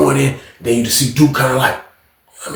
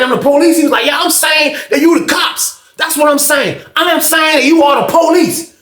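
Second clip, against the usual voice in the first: mock yelling confrontation; 13 semitones higher than usual. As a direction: shout the challenge at the door. Duke and old man confront.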